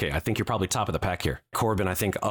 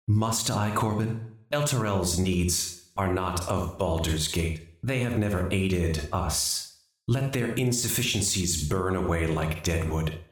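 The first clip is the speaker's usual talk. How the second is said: normal talking voice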